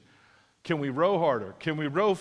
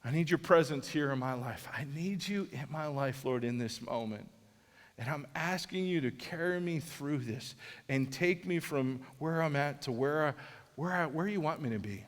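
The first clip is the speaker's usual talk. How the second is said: soft, low prayer to the Lord; quiet prayer; 4 semitones lower, 6 dB quieter than usual. Acted. Preacher praying to the Lord, soft and low. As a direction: pray it softly, head bowed.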